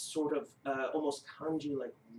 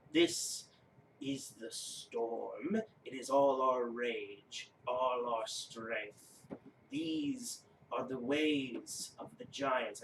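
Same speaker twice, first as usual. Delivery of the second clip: slow grave giant; much slower than usual. Acted. Giant unveils storm rune to player, slow and grave. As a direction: weigh each word, solemn and grand.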